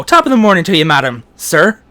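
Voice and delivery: loud stage-Irish greeting; Irish accent. Shown as a demonstration. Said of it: "to get into an Irish accent".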